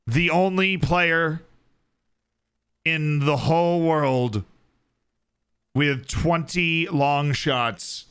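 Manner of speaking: slow, spaced mock announcement; mock-grand build-up. Hyping an obscure player's stat in a slow mock announcement. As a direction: draw each phrase out for effect.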